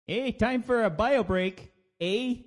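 high, slow mock-Canadian accent; American putting on a hammy Canadian accent. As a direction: call it out like a cartoon Canadian. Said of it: "my American doing a Canadian accent".

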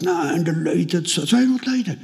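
high-pitched imitation of an impressed friend; protesting friend. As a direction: protest in a put-on voice, climbing very high. (hoher, nachgeahmter Protest)

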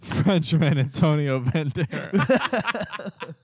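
slow drawn-out name through a laugh. Pretend. Joking mispronounced name.

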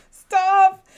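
high, loud squeal of disgust; real squeal of disgust; squealing stop. Real, not acted. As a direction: shriek it, can't bear to watch. Reacting to gory scene.

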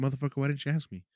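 fast, high incredulous rant; exasperated inner voice. Retold or imitated. Thinking about the mugger, fast high exasperation. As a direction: rattle it off in disbelief. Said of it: "in the back of my mind".

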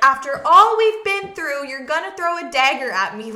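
loud, hammed-up wounded protest; mock dramatic outrage; overly dramatic love interest; dramatic hurt lover. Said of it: "he's being very dramatic".

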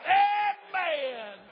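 high, loud, drawn-out amen; shouted amen. Real, not acted. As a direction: holler it out to the congregation. Preacher crowning his hollered story.

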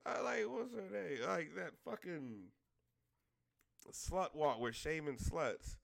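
ordinary delivery

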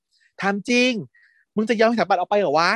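high-pitched retold challenge; goading challenge. Student egging his friends on, goading challenge.

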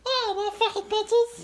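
very high little-creature voice; Xavier. Acted. Tiny companion tells host what he's been up to, high squeak. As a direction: squeak out a tiny critter's reply. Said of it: "What have you been up to, little guy".

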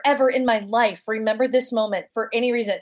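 ordinary talk

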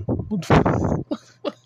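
slow, breathy disbelieving what; incredulous. Real, not acted. To critics quizzing him, a slow stunned what. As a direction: drag it out in disbelief. Incredulous reaction after quote.

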